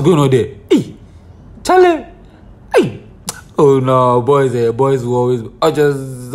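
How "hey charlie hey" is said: high shout of a name across the room; calling out. Real, not acted. Hailing Charlie across the set with a high call. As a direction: call him from far off.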